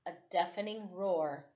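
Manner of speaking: usual speaking voice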